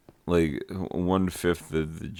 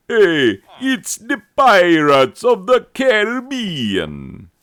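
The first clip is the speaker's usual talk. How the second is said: very loud, high, stretched-out accent, Caribbean as CARE-uh-BEE-un; loud put-on accent; 14 dB louder, slower than usual. Shown as a demonstration. Teacher mocking the other pronunciation, in a blaring put-on accent. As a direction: belt it out in an exaggerated high accent. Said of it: "you have to say it like this".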